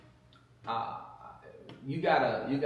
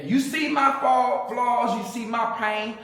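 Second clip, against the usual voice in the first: much higher and louder, selling the line; hammed-up smooth talk breaking into a laugh; animated quote; 9 semitones higher, 7 dB louder than usual. Retelling his pitch to her.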